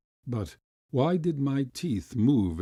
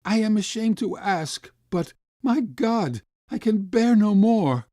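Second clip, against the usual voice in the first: high, loud, trembling plea; a desperate father begging strangers; hollow shaking voice; 10 semitones higher, 7 dB louder than usual. A narrator's reading. Starving father begging gentlemen for help, shaking. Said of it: "in a hollow and shaking voice".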